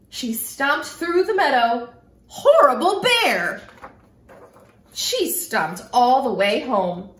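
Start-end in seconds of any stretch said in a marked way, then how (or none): 2.3-3.6 higher, louder cross shout; angry stomping yell. Acted. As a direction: yell it again as she stomps off. Girl stomps, repeating her cry.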